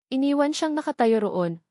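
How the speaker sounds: plain reading voice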